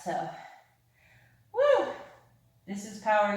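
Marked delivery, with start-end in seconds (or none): 1.5-1.9 high excited woo; excited whoop. Real, not acted. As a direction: whoop to pump up the class. Energetic yoga cheer.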